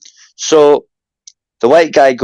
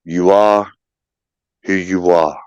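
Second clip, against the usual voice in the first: lightly voiced God; 8 semitones lower, slower than usual. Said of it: "And God says".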